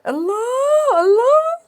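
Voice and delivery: overwhelmed startled cries; startled squeal. Real, not acted. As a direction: gasp in shocked delight. Mic startles her.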